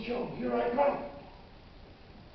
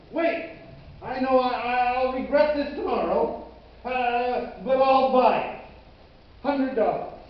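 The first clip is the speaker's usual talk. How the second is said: louder, higher, committing on impulse; eager buyer; 5 semitones higher, 8 dB louder than usual. Acted. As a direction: blurt out the offer, loud and high. Eager buyer in acted bargaining.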